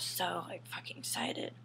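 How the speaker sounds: soft, unvoiced aside; hushed sarcastic aside; whispered aside. Real, not acted. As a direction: drop to a whisper mid-story.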